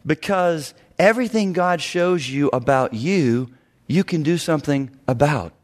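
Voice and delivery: ordinary delivery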